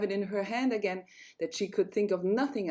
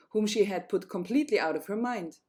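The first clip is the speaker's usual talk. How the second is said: plain reading voice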